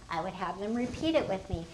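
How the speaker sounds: normal talking voice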